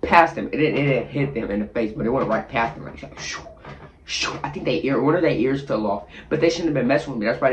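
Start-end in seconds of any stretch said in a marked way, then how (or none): 3.2-4.3 whooshing sound. Retold or imitated. Machetes flying past.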